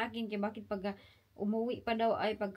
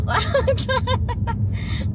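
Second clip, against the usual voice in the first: loud high laugh; 14 semitones higher, 12 dB louder than usual. Real, not acted. Big laugh.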